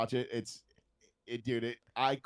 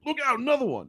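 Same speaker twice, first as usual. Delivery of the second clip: much higher, louder laughing mock voice; imitating comedian; 6 dB louder, faster than usual. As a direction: blurt it like a gleeful bystander. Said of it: "Shane Gillis summed it up".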